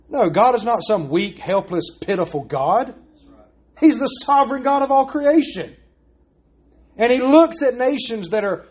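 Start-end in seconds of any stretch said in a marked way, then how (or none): none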